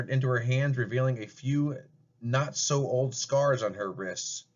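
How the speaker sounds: ordinary talk, nothing marked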